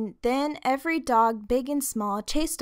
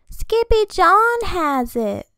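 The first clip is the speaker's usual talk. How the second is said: put-on little girls' voice; 9 semitones higher, 8 dB louder, slower than usual. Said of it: "said the sisters".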